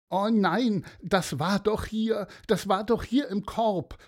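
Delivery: put-on high, worried child's voice; a small boy panicking over something lost; upset little boy. Small boy at the beach calls out in dismay. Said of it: "rief ein Junge".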